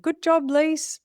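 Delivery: high, bright-up mock boss praise; imagined praise. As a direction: pitch it up like someone praising you.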